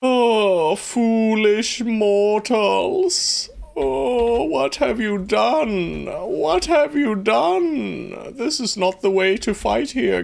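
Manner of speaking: high, slow villain voice; dark lord. Pretend. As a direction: taunt them like a dark overlord. Mock villain taunting his troops.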